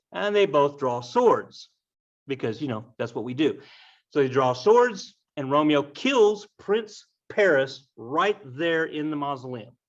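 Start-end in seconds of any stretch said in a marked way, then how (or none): none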